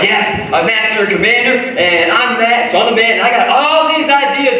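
lightly voiced boastful me; mock proud self. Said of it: "I'm like".

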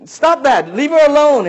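high, loud warning yell. Retold or imitated. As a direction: shout him off the woman. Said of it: "yelling at the guy".

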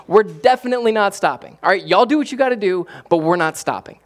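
high, loud, fired-up defiance; defiant apostles. Acted. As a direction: throw it back at them, no backing down. Apostles defy the council, voiced.

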